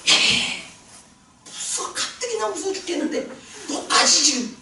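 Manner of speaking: high frightened squeal with a laugh; scared friend voice. Retold or imitated. Preacher voicing a scared friend in the dark, high frightened cry. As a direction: act out the terrified friend, high and shaky with a laugh.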